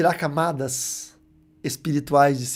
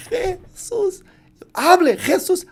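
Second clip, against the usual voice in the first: put-on boy's first word; much slower than usual. Formerly deaf boy saying his first word, high and hesitant. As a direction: struggle the word out high like a child's first try. Boy repeating after the preacher.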